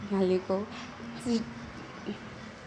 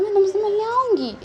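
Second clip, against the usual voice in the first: eager promise; 12 semitones higher, 6 dB louder than usual. Acted. Sister promising to visit Wing.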